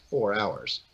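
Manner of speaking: ordinary talk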